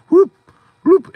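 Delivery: sudden loud, high-pitched whoop. Shown as a demonstration. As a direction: let out a sharp high whoop. Imitated pattern-interrupt noise mid-rant.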